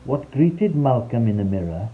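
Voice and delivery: plain reading voice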